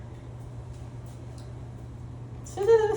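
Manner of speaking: a long, high, airy burst of laughter. Real, not acted. Seeing her book stack, she bursts into a long laugh. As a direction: break into real, helpless laughter.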